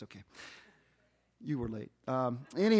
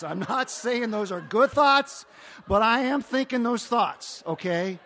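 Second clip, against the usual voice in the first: emphatic confession; 7 semitones higher, 11 dB louder, slower than usual. Real, not acted. Confessing embarrassing thoughts to congregation.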